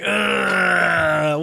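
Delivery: long drawn-out "ugh"; drawn-out uneasy groan; cringing groan. Acted. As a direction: groan in mock embarrassment. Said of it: "you're kinda like".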